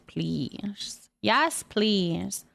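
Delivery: ordinary talk, nothing marked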